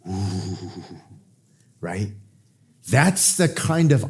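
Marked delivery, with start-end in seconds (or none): 0.1-1.0 low, soft, wavering shiver sound; shuddering "ooh". Retold or imitated. Hyenas hearing the name Mufasa, imitated shudder. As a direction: shudder through a quiet mock-fearful "ooh". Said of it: "When the hyenas heard the name Mufasa".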